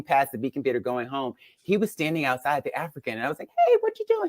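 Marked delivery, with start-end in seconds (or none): 3.7-4.3 high-pitched mock flirty hello; playful high quote of herself; retold flirty greeting. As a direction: re-enact a coy greeting, pitched way up. Retelling flirty street encounter.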